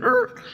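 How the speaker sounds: short, high-pitched "er"; high little squawk of a halt; screeching-halt noise. Retold or imitated. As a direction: imitate a sudden screeching stop. Imitates horse stopping abruptly.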